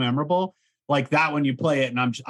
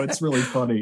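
usual speaking voice

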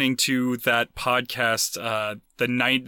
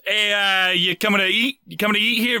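badly faked thick Boston doorman, higher; thick Boston accent; 4 semitones higher than usual. Doorman at Cheers asking a customer in, mock Boston accent. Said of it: "real thick accent".

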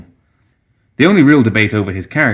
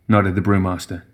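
plain reading voice

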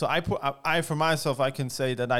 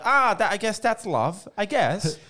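resigned; 8 semitones higher than usual. Retold or imitated. Resigned realization, pitch clearly up.

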